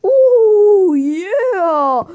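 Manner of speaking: high, loud drawn-out wail; play-acted figure wailing with rising and falling swoops; Paul Bearer. Toy manager calls out on his knees, high mock wail. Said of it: "we get Paul Bear ... and he's all like".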